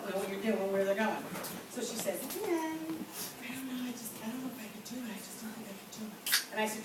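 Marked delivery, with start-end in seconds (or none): 2.2-6.1 soft, hesitant self-doubt; doubtful trainee. Retold or imitated. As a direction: imitate an unsure trainee, trailing into softness. Doubtful trainee voiced in story.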